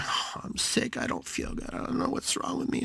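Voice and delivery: faint, whispery invalid's moan; weak sickly complaint.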